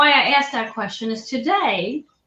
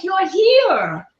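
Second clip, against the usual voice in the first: higher-pitched imitation of a delighted welcome; retold excited greeting; 8 semitones higher, 4 dB louder than usual. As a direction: greet an unexpected visitor with excitement. Gym owner greets her in retelling.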